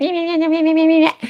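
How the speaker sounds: high gibberish imitation of a singer; roach-voice babble. Showing how he sounds to her, high roach-like babble. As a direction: babble wordlessly in a high, bug-like voice. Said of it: "He sounds like a roach".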